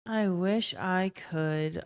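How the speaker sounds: slow, soft I wish I could, trailing off; wistful quiet repeat. Real, not acted.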